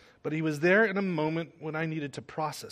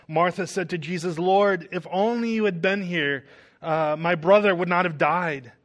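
louder, solemn Bible reading to the congregation; scripture reading; 3 semitones higher, 7 dB louder than usual. Acted. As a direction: read the passage out with full voice. Reads the Gospel of John aloud.

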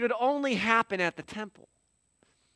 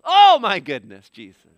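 amazed gasp; 4 semitones lower, 13 dB louder than usual. Acted. Crowd reacts to healing.

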